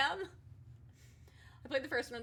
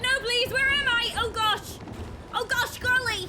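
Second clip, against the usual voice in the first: high, loud panicked pleading; terrified gibbering; 9 semitones higher, 10 dB louder than usual. Pretend. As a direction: beg in mock panic. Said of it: "I said".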